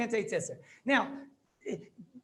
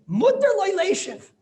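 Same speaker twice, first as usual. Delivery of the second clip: higher and louder, slow and triumphant; excited ruling; 10 semitones higher, 10 dB louder, slower than usual. Real, not acted. Rabbi reading the ruling to his class, thrilled. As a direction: announce the ruling like a discovery.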